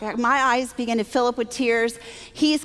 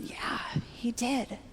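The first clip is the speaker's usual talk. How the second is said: slow, whispered retold answer; hushed admission; 11 dB quieter, slower than usual. Answering his wife about the lost job, whispered. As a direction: confirm it gently in a slow whisper. Quiet answer to the wife's question.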